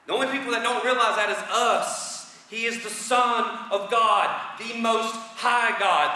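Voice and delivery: loud, high, emphatic proclamation; forceful preaching. Real, not acted. Preacher rising to full force.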